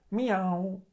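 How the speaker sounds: high, drawn-out meow; cat meow. A narrator's reading. The cat answers Willem stroking his back, high meow. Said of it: "zegt Dikke Teun".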